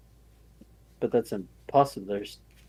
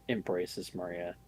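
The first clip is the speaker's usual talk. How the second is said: ordinary delivery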